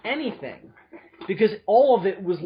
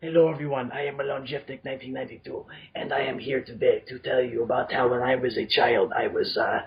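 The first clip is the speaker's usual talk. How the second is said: low, creepy impersonation; Milan; 7 semitones lower than usual. Retold or imitated. Host imitating creepy Milan telling a childhood story, creepy low voice. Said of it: "he did creepy Milan".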